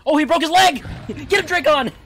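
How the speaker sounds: high, loud laughing call; excited shout. Real, not acted. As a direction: yell it through laughter at the chase. Calling out during a chase.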